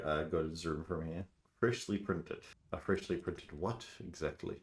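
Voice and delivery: ordinary talk, nothing marked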